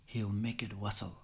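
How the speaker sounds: slow, measured Scots poem recital; poem recitation. Acted. Reciter gives Burns' Address to a Haggis, slow declamation. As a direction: declaim it slowly, line by line, with weight.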